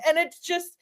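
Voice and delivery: ordinary talk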